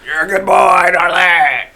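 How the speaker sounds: big drawn-out call to someone far ahead; yelling. Acted. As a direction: yell it from the back of the line. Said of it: "Xantor yells from the back".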